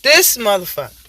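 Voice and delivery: normal talking voice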